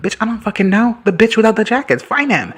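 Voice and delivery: high, loud mock-annoyed voice; exasperated snap. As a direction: play a fed-up teen snapping at a student. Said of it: "He's like".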